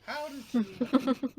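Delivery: very loud, high, drawn-out disbelief; slow, high, shouted astonishment; real incredulity. Real, not acted. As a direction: cry out in amazed disbelief. Incredulous at the dice roll.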